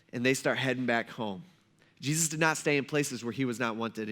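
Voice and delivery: ordinary delivery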